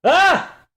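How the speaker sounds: very high, loud scream of terror; a scream when the gun seems to fire; terrified yell. Acted. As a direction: shriek as if the shot hits you. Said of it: "let out a yell".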